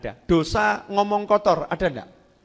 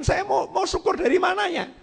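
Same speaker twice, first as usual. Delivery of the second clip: much higher, bitter shouted protest; indignant shout; 13 semitones higher than usual. Retold or imitated. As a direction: throw the question out in angry despair. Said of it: "Ini orang udah kufur pol begini". (protes marah dengan nada jauh lebih tinggi)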